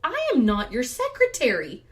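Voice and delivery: loud, emphatic mock outrage; indignant comeback. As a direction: put him firmly in his place, retold. Retold comeback to husband.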